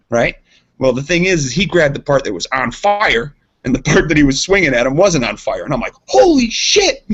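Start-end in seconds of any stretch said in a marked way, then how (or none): 6.1-7.0 high, loud retold "holy shit"; shocked yelp. Friend telling podcast hosts about the fire, shocked high yelp. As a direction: yelp it way up in disbelief.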